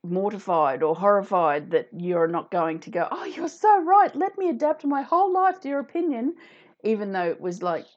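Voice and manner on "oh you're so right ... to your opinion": high, louder, exaggerated fawning; mock gushing agreement. Imagined reply to a pushy adviser, mock gushing. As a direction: gush as if utterly won over, clearly mocking.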